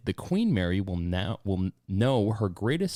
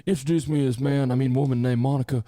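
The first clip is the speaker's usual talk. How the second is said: president impression; 4 semitones higher, 3 dB louder than usual. President voice retelling Monica story.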